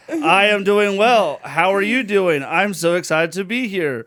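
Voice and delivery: slow, loud stiff frat guy. Retold or imitated. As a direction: recite it like a nervous frat guy. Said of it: "that sounded like a frat guy".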